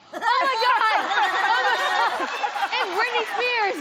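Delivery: high, loud laughing shriek. Real, not acted. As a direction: scream it out laughing. Contestant shocked by answer.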